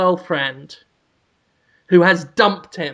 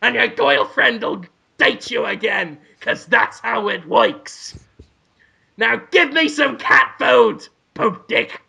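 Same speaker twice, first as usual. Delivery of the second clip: high, brash cartoon cat; bossy cat ordering its owner about; put-on pushy character voice, pitched up; Willem Dafoe; 4 semitones higher than usual.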